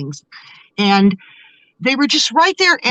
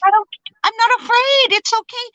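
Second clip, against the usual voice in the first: high-pitched, insistent mock reply; excited retold reassurance; 13 semitones higher than usual. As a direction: act out reassuring a panicky friend, pitched high.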